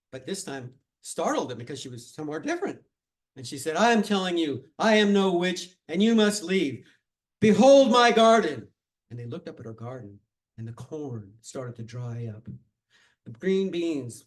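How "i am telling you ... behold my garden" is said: loud, higher, grand declaration, slowing at the end; commanding proclamation. Acted. Amparo startles the crowd from a new spot, loud grand command. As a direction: proclaim it like a force of nature. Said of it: "she spoke again".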